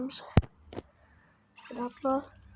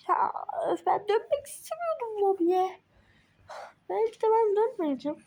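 higher, louder complaint; loud higher-pitched griping; whiny protest; 7 semitones higher, 4 dB louder than usual. Real, not acted. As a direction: whine it at the game. Protesting being sent to lobby.

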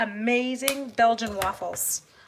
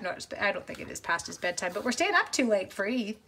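normal talking voice

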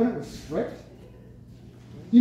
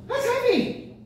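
emphatic exclamation; 12 semitones higher, slower than usual. Real, not acted. Preacher exclaims at a point.

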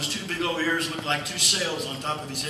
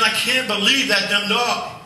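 slow, louder exasperated rant; 5 semitones higher, 5 dB louder than usual. Retold or imitated. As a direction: vent at yourself, fed up. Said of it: "talking to myself".

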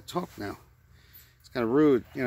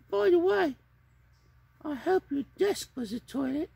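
very high squeaky puppet voice in fake French; high puppet babbling mock French; 4 dB louder, slower than usual.

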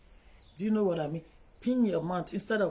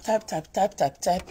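rattled off mockingly; mock busy typing; 5 dB louder than usual. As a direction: mimic someone typing away. Mocking people typing fast.

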